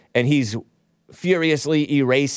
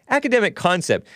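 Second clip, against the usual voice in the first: "Academic concept" repeated in a much higher, mocking tone; sarcastic echo; 7 semitones higher than usual. Real, not acted. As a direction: throw the phrase back with scorn. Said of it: "They make it sound so vanilla".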